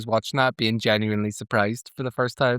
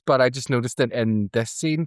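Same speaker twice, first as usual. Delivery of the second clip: ordinary delivery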